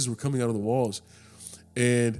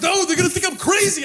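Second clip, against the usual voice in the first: high, anxious put-on voice; scared driver; 6 dB louder than usual. Imitating the scared driver refusing, high and anxious. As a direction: voice the frightened driver.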